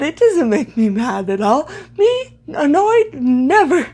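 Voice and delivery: mock-innocent denial, pitch leaping up on the question; exaggerated sarcastic disbelief. Real, not acted. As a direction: feign surprise at the very idea, dripping sarcasm. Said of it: "I, I'm being sarcastic".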